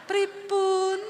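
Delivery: high, slow, sing-song wifely voice; drawn-out syllables, sweetly deferential; dainty Javanese wife. Retold or imitated. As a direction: put on an exaggeratedly polite Javanese wife. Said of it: "saya ngomong begitu sampai malam".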